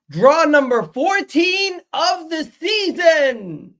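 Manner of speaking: high, slow, drawn-out yell of frustration; exasperated shout. Real, not acted. A fan shouts his team's fourteenth draw of the season, slow and high. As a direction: belt it out like a fed-up fan.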